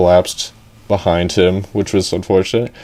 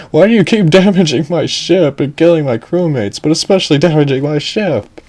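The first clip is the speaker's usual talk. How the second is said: high, raised complaint at the frog; exasperated captain; 6 semitones higher than usual. Acted. Captain yells at the frog over his ship, exasperated. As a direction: scold him for wrecking your ship.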